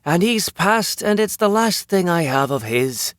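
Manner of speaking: high, laid-on Irish lilt; thick Irish accent. A narrator's reading. Woman stalling a robber for her lighter, thick Irish accent. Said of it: "She laid her Irish accent on thick".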